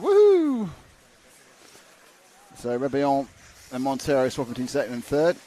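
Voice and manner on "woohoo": high, loud celebratory whoop; imitated woohoo. Commentator mimicking a driver's celebration, high mock whoop. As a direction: let out a long, high "woohoo". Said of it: "It did look kind of".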